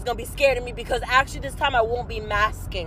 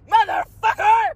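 much higher, louder curse; frustrated yell; 7 semitones higher, 3 dB louder than usual. Real, not acted. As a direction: spit out the swear in frustration. Venting frustration loudly.

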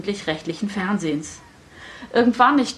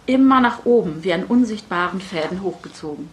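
usual speaking voice

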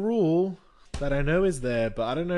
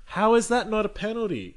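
outraged; 8 semitones higher, 6 dB louder than usual. Retold or imitated. Fan outraged at referee call.

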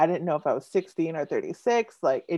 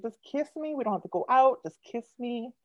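playful pleading; 7 semitones higher than usual. Retold or imitated. Poet imagines asking northern boys for kisses, playfully pleading. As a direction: beg lightly, pitched right up.

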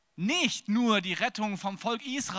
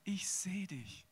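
God's gentle answer; 5 semitones lower, 7 dB quieter than usual. Acted. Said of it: "Und El Roi sagt".

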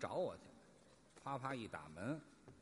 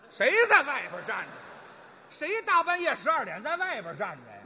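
loud, higher comic outrage; indignant objection; 4 semitones higher, 6 dB louder than usual. Acted. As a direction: object loudly in mock disbelief. (大声不满地反问)

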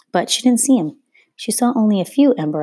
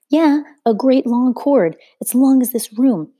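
put-on excited boy; 7 semitones higher, 5 dB louder than usual. A narrator's reading. Excited boy describing brother's whip.

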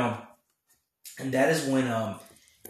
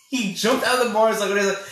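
ordinary talk, nothing marked

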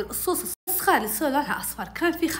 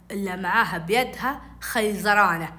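ordinary talk, nothing marked

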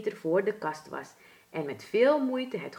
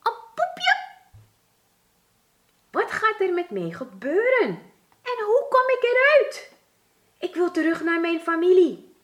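high squeaky panicked mouse voice, louder; frightened mouse; 12 semitones higher, 7 dB louder than usual. A narrator's reading. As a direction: piep het uit van schrik, hoog en luid. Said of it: "beefde en deed van schrik". (hoog, luid, angstig muizenstemmetje)

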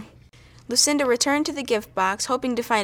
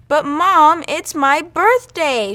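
high, loud, slow pouty protest; sulky kid pleading with mom; pouting; 6 semitones higher, 8 dB louder, slower than usual. A narrator's reading. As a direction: pout and drag out the complaint. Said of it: "she pouted".

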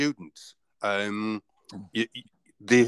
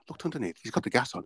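ordinary delivery

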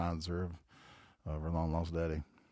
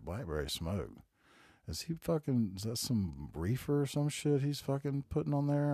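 incredulous; 7 semitones higher than usual. Retold or imitated. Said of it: "And I was like".

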